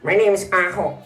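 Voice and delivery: a lightly put-on dummy voice; puppet voice. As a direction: introduce yourself as the puppet. Puppet introduces itself.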